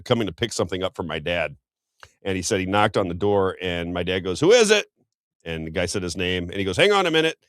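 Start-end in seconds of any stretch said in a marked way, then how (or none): 4.4-4.8 high, loud call through a door. Retold or imitated. Son imitates dad answering a knock, calling out. 6.8-7.4 high, raised call to wait. Retold or imitated. Son imitates dad holding off a visitor, calling out.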